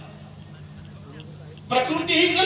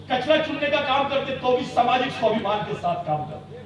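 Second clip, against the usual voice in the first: usual speaking voice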